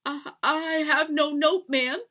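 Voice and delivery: high, halting denial; timid denial. A narrator's reading. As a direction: stammer it out to the mistress. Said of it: "I said quiet".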